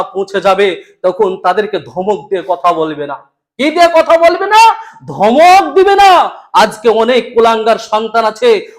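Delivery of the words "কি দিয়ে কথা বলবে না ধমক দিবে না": emphatic preaching. Acted. Rhetorical question and answer.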